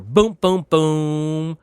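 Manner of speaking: punchy mouth-made booms; gunfire noises. Retold or imitated. Recapping a movie fight, booming blaster sound effects. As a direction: make the blasts with your mouth. Mimicking alien blaster fire.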